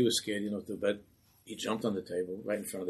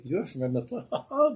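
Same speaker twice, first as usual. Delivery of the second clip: mocking laughter; 7 semitones higher, 5 dB louder, slower than usual. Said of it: "They all start to laugh".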